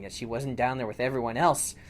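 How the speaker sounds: ordinary delivery, nothing marked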